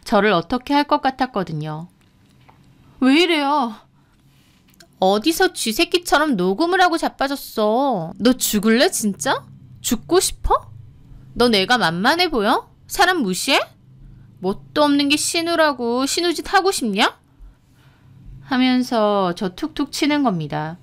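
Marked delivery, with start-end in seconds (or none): 3.0-3.7 pitched-up, alarmed question; startled and defensive; alarmed protest. Acted. Frightened victim being approached. 5.0-17.2 angry questions swinging up high; bully them with one challenge after another; menacing, contemptuous tirade; thuggish threatening. Acted. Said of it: "깡패처럼 위협하는데".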